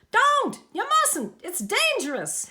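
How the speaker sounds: very high, loud panicked warning; alarmed cry. A narrator's reading. Hector warning Lyle plunging into the river, alarmed. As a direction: cry out to stop him. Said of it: "Hector cried out".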